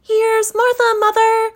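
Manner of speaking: a daughter announcing herself brightly; high girl's voice calling mother; young girl's voice. Acted. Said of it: "said a girl".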